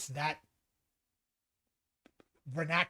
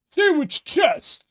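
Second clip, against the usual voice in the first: higher, louder mock-hyped slang line; mock hype; 9 semitones higher, 7 dB louder than usual. The host mimics people using trendy slang, loud and mock-hyped. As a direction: toss it out like a cool kid hyping someone up. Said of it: "I've been hearing this a lot lately".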